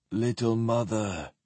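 soft, hollow specter voice; hollow intoning. A narrator's reading. Dead knight's shade greets Sephrenia, hollowly. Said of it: "it intoned hollowly".

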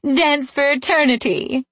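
high, lilting, mocking invitation; laughing fey taunt. A narrator's reading. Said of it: "they laughed".

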